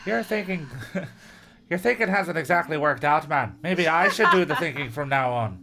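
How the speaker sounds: acted angry challenge; fed-up villain. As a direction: berate your partner. Sal angrily berates his partner.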